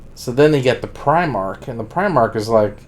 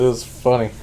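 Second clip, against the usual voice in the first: ordinary talk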